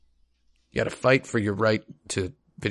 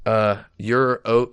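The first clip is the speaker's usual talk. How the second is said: ordinary delivery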